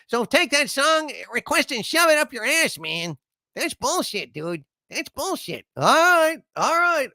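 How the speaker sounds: high-pitched outraged god voice, telling them off; fed-up high rant, waving the request away; vexed Harvey. Acted. Harvey telling a viewer where to shove the request, high and outraged. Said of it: "is very vexed by that last super chat".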